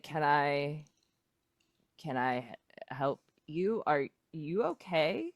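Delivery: low, quiet, halting question; bewildered mayor. Acted. Puzzled mayor to kids doing tricks on his lawn, bewildered. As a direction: falter warily, voice dropped low. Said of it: "he just kinda looks at you".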